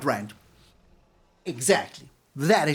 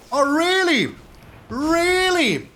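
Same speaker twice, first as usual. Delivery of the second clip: mock disbelief; 7 semitones higher, 3 dB louder, slower than usual. Mock disbelief at opponent's play.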